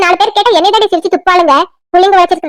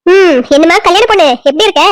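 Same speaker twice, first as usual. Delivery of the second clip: ordinary talk, nothing marked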